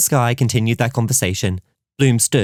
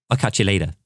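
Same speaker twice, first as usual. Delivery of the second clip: ordinary delivery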